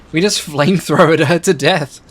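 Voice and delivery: loud, high burst of disbelieving glee; gleeful laughing. Real, not acted. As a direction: crow it, breaking into laughter. Gleeful at unexpected sinking.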